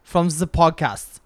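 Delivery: loud, high, hammed-up German accent; slow, emphatic mock German, declaimed loudly. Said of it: "Nick has a really good German accent".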